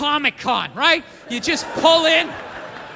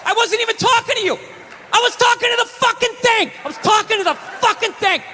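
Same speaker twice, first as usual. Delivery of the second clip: defensive yell; 8 semitones higher than usual. Acted. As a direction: snap back defensively.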